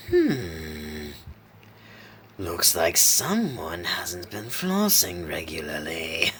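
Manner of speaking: slow, whispery swooping rasp; muse, then draw out the taunt; mocking fiendish clown. A narrator's reading. Clown inspects Isaac's exposed teeth, mocking rasp. Clown mocks his mutilated victim.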